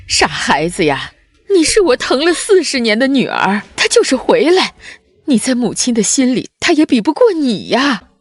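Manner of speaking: loud, heartfelt protest, rising in pitch; emotional reassurance. Acted. Mother insists her daughter comes first, emotional.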